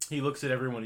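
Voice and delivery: ordinary delivery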